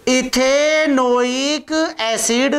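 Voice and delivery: syllables stretched right out; slow dictation. Real, not acted. Teacher names the compound for students, slow dictation. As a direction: draw the name out as you write.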